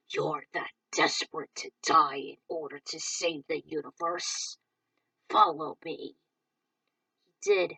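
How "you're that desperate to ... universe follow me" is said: low menacing taunt; distorted villain voice. Acted. Villain taunting Rick, low and menacing. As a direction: sneer at his desperation.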